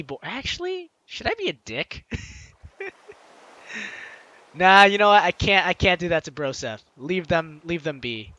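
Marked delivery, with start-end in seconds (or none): none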